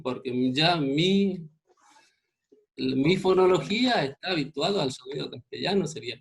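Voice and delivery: usual speaking voice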